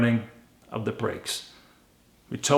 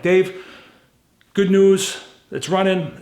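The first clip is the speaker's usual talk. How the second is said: higher, louder "Dave, good news"; excited call; 6 semitones higher, 6 dB louder, slower than usual. Retold or imitated. Calling Dave about the running car, excited. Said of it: "I'm like".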